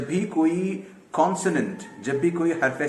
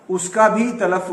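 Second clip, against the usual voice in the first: ordinary talk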